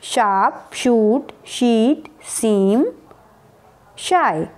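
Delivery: शार्प, शूट, शीट with श, सीम with स; odd-word drill. Shown as a demonstration. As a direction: read the row for spotting. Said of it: "कौन सा आपको odd लग रहा है". (स और श में फर्क करके पढ़ना)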